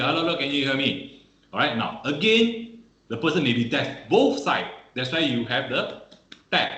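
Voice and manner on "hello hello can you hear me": usual speaking voice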